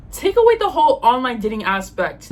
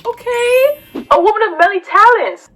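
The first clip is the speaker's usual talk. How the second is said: high, loud, impressed cheer; big high-pitched hype-up; hyped approval; 9 semitones higher, 9 dB louder than usual. Acted. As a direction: gush loudly in a high pitch. Hyped reaction to list of hobbies.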